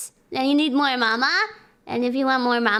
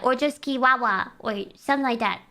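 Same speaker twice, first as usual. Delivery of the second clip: usual speaking voice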